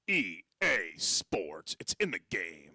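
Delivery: low, slow announcer impression; sports ad announcer. Comic at sixteen copying a game ad, low announcer impression. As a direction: drop low and draw out the slogan. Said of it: "I heard the E A Sports ad".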